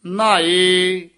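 ਨਹਾਏ said slow and weighty; heavy pronunciation. Shown as a demonstration. Teacher showing the heavy sound: ਨਹਾਏ slow and weighty. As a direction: lean on ਨਹਾਏ and draw it out. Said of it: "ਨਹਾਏ ਪਦ ਜਿਹੜਾ ਭਾਰਾ ਉਚਾਰਨ ਕਰਨਾ ਹੈ".

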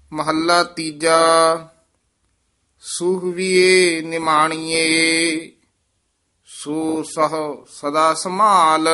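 measured, sustained verse recital; scripture recitation. Acted. Teacher reciting scripture for students, measured recitation. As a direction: recite sacred verse with drawn-out line endings.

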